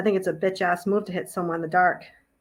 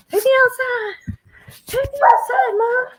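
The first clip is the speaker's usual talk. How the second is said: high squeaky dog voice begging; voicing the dog; 6 dB louder than usual. Owner voicing her dog asking mom to go outside, high squeaky pleading.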